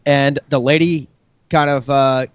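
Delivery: normal talking voice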